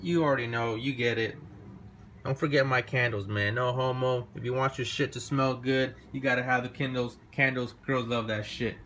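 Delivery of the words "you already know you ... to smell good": ordinary talk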